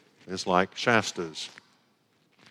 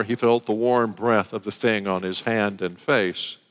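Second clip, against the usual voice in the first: usual speaking voice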